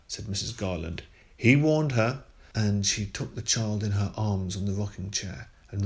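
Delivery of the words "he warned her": high, loud declaration; mother insisting God protected her; fervent. A narrator's reading. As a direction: proclaim it with conviction.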